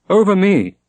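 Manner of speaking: high, loud, bitter snap; a furious daughter; harsh retort. A narrator's reading. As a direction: throw it back at them. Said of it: "said Shonnet harshly".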